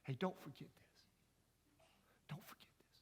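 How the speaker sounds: soft hushed whisper to the crowd. Real, not acted. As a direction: lean in and whisper it like a secret. Preacher drops to hushed aside.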